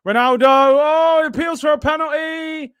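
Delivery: real startled fan yell; sudden excited shout. Real, not acted. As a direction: jump up as the penalty claim comes. Sudden penalty appeal on stream.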